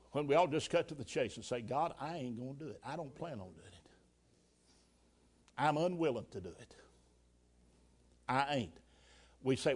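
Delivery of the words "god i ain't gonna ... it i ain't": muttered refusal. Retold or imitated. Congregation's honest refusal.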